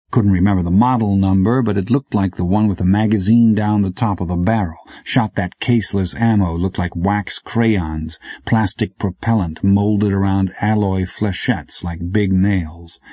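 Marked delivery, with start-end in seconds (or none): none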